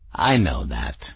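low, slow, regal declaration; smug cat. Acted. Cat answering Bridget, low, slow and smug. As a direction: announce it as self-evident truth.

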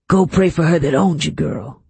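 forceful, snarled command; contemptuous dismissal, hard-driven words; angry order. A narrator's reading. Master shoving the girl toward the cemetery, angrily commanding.

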